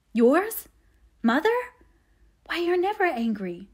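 high, rising questions of disbelief; surprise. A narrator's reading. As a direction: react with startled amazement. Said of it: "Jo forgot remorse and surprise".

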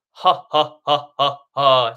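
loud, punched, separate belly-laugh pulses; staged full laugh as a breath exercise; demonstrated belly laugh. Shown as a demonstration. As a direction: push out deliberate ha's from the belly, last one strongest. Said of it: "kind of a deep, full laugh".

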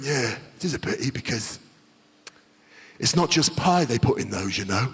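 low, soft Cockney hint; sinister Cockney cabbie. Retold or imitated. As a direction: drop the voice like sharing a dark secret. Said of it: "Proper Cockney".

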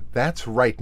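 plain reading voice

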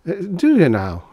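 high, loud, intrigued query; piqued despite himself; reluctant interest. A narrator's reading. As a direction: let curiosity lift the voice. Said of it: "becoming interested in spite of himself".

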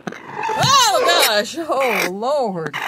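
high loud frightened cries; startled fear. Real, not acted. Hen lunges at her hand, high loud fear. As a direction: cry out as the beak comes at you.

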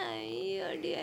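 very soft, drawn-out cooing; soft pity. Real, not acted. Onlooker cooing at the teased child, soft pity. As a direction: draw it out slowly and quietly.

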